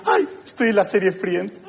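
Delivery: slow, dreamy starstruck mock voice; a hint of a starstruck fool; dopey delight. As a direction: play a gullible fan in awe. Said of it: "ver un sofá y decir".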